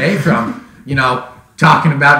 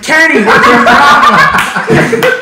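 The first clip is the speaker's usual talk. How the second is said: slow, laughing, raised mock call; mocking classmate; 12 semitones higher, 6 dB louder, much slower than usual. Classmate teasing Kenny on the bus, slow laughing mock. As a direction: drag it out through laughter. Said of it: "me or somebody was like".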